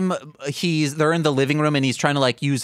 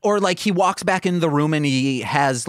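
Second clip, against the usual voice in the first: usual speaking voice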